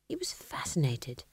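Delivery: soft whisper of wonder. A narrator's reading. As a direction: breathe it in awe. Death marvels quietly.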